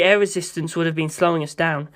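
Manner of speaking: plain reading voice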